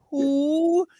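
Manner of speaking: high, dismissive "Who?"; a bored diva who never heard of you. Retold or imitated. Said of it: "my version of [name]".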